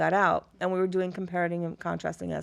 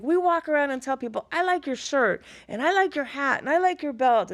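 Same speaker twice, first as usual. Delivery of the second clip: cheery student; 7 semitones higher, 3 dB louder than usual. Retold or imitated. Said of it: "He's like".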